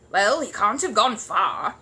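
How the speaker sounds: slow, loud gruff neighbour. Acted. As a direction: brush it off gruffly. Mr Curry gruff.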